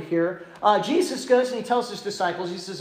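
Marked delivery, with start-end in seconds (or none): none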